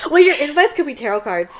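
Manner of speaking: high, loud laugh into the idea; giddy laughing pitch to a friend; laughing excitedly. Real, not acted. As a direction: burst out laughing with delight.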